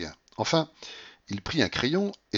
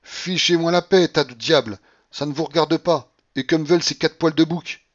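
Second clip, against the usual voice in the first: high, loud furious tirade; full-voiced shouting; 8 semitones higher, 8 dB louder than usual. A narrator's reading. Babouchka to those holding her back, shouting. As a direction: drive the whole crowd off. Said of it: "elle criait à pleine voix".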